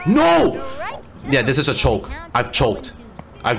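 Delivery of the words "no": sudden high, loud cry of dismay; dismayed shout. Real, not acted. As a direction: yell out as you blow it. Real cry at choking the play.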